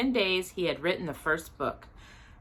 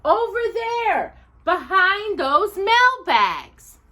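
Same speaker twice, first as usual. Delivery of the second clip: much higher, louder, slowing insistence; insisting; 13 semitones higher, 6 dB louder, slower than usual. Acted. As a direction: press the point on his wife. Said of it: "he insisted".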